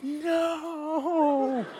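long, high, soft wail; mock despair. Driver seeing police behind, wailing in mock despair. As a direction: stretch it into a comic lament. Despair at being pulled over.

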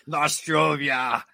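slow Russian sign-off toast; drawn-out toast. Acted. Russian host toasting goodbye to the interviewer, drawn out. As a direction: stretch the toast out in a Russian accent.

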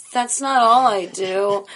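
high, slow, offended protest; indignant protest. Retold or imitated. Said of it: "I'm going".

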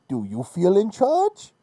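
hint of Bane, slow high rising question; lightly put-on villain, dropping back at the end; Bane quote. Host quoting Bane at Dean to taunt him, mock menace. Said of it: "To quote Bane".

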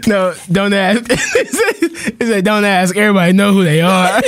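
high-pitched, broken up by real laughter; laughing while quoting. Real, not acted. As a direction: repeat the quote while fighting giggles. Rereading line while laughing.

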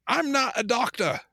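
a light imitation of a Scottish accent. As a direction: mimic a film actor's Scottish accent, lightly. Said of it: "doing his full Scottish accent".

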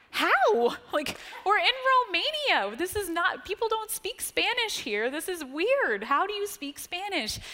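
high, loud, incredulous outburst; retold astonishment. Speaker to a Romanian girl who somehow speaks Spanish, astonished. Retelling her astonished reply.

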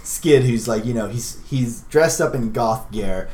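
usual speaking voice

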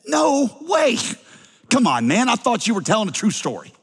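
mock outrage, as if the story cheated you; incredulous protest. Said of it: "his hearers would have said".

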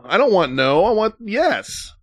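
put-on high, slow, childish "I want yes"; whiny pleading voice. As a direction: drag out the plea like a sulky kid. Mocking the yes guy's refusal.

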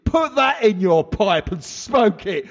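high, smug crook voice; smug criminal voice. Acted. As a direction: gloat like a cocky villain. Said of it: "I don't have to do that voice every time".

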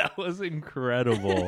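words through laughter; real amused laugh. Real, not acted. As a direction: still laughing as you speak. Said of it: "laughing our asses off".

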